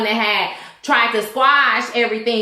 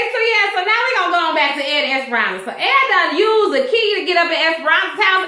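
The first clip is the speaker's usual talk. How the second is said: ordinary talk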